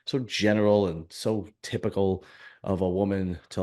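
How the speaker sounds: normal talking voice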